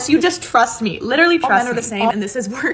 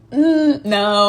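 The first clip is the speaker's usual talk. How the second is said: high, loud "Mm, no"; knowing, loud "no"; sassy refusal; 3 semitones higher, 6 dB louder than usual. Real, not acted. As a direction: shut the excuse down with attitude. Dismissing his excuses.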